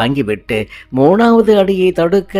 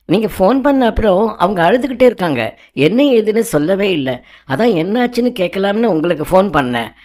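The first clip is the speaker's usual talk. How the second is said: fast, higher-pitched rush of explanation; hurried; 6 semitones higher, faster than usual. Acted. Venkat explaining Maya's crying on the phone, hurried. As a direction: rattle it out in haste. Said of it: "என வேகமாக வெங்கட் பேசி முடிக்க".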